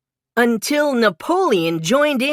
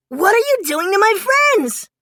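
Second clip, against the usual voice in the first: much higher, louder alarmed cry; 12 semitones higher, 3 dB louder than usual. A narrator's reading. Mikey confronts the frogs holding his friends, high alarmed cry. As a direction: demand it in alarm. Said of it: "cried Mikey".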